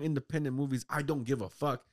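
usual speaking voice

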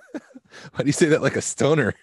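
asking through a high laugh. Real, not acted. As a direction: laugh out the question. Laughing at friend's voice.

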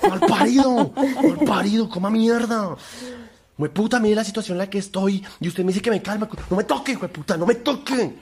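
high, loud screaming rage; furious yell. Retold or imitated. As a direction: explode at the smug partner. Imitating furious partner's outburst.

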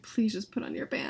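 quiet, understated retold plea; retold weary plea. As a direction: plead softly, as if worn down. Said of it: "they've seen me cry over".

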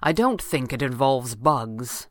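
slow, low, grim reassurance. A narrator's reading. Red Panda reassuring her about bugs, grimly. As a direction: draw it out grimly. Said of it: "the Red Panda said grimly".

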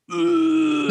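high, drawn-out ugh of disgust; imitating a disgusted groan; mock disgusted groan. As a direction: groan like you're repulsed. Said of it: "he was like".

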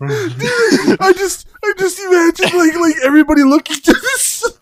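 very high, squeaky from laughing; wheezing with real laughter; helpless laughter. Real, not acted. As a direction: struggle to talk while cracking up. Cracking up while describing the scene.

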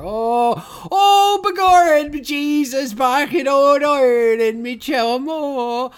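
high, loud, hammed-up Irish accent; terrible Irish accent. Co-host mocking an actor's bad movie brogue, over-the-top Irish accent. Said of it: "one of the worst Irish accents".

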